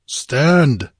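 a hero's sharp command; barked order. A narrator's reading. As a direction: order them to halt. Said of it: "he ordered".

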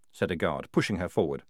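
usual speaking voice

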